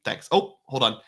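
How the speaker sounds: ordinary talk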